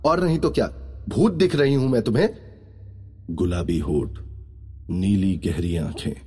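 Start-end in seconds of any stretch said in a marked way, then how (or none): 0.0-2.3 put-on high, indignant girl's voice; shocked girl. A narrator's reading. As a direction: answer in high-pitched disbelief. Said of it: "shocked होते हुए बोलती है".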